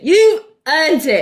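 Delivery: slow, loud, high congratulation; playfully hammed-up reward; emphatic retold praise. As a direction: stretch it out with mock-grand approval.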